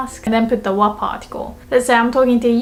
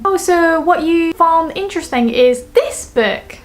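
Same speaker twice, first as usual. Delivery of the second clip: higher-pitched "oh, I see"; raised, playacted dawning understanding; acted realization; 6 semitones higher than usual. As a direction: play the listener working out what was meant. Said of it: "but it would mean".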